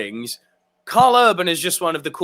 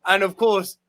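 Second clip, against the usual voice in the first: ordinary talk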